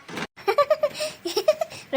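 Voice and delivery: high, drawn-out cackle; long mischievous laugh; mocking laugh. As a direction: cackle at the threat. Laughing at her own threat.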